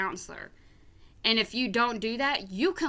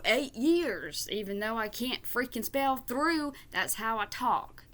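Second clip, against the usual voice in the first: put-on Southern accent. Irritated vlogger mocking a commenter who claims to be a counselor, Southern accent. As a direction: put on a Southern accent, stretching key words. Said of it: "did that in a fucking Southern accent".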